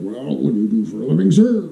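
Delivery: lightly voiced father, low and soft; a hint of a questioning father; Victorian father. Father vetting his daughter's suitor, mock low voice. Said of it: "the father would be like".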